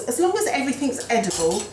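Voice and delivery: ordinary talk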